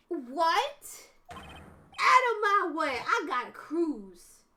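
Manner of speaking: loud Brooklyn tough guy; Brooklyn accent. Acted. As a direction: yell it in a street Brooklyn accent. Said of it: "my best Brooklyn accent".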